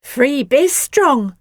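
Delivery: put-on high, slow child voice; girl staring at the roof; echoed. A narrator's reading. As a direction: echo it in awe. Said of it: "echoed Natalie".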